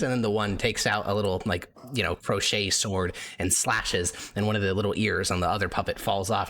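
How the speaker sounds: normal talking voice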